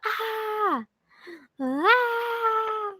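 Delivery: high repeated shouted ahs, dad's sleep cries; two sharp high yelps like dad; imitated sleep yell. Imitating dad crying out on trips at night. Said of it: "he'll go".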